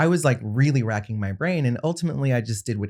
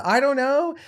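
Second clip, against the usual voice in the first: much higher, sheepish squeak; flustered squeak. Retold or imitated. Younger brother caught out by question, high flustered squeak. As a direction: answer way up high, comically caught out.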